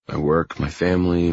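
ordinary delivery, nothing marked

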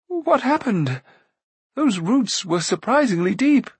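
high, bright-pitched princely voice; guileless dazed prince. Acted. Fallen prince to his rescuers, puzzled and high. Prince figure coming round.

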